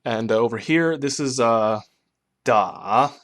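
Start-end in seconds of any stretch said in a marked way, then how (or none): 2.4-3.1 "da" dips down then rises; dipping tone da. Shown as a demonstration. Teacher shows third tone dip on da. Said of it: "pitch goes down and then back up".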